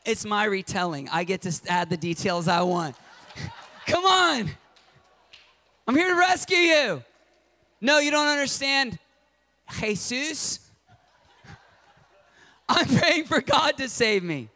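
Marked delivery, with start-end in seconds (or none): 3.9-7.0 high, loud urgent call; rescuer calling out. Retold or imitated. Helicopter rescuer urges drowning man, loud high call. 7.8-14.5 high, insistent with a laugh; stubborn refusal. Retold or imitated. Drowning man refuses helicopter rescuer, high insistent voice.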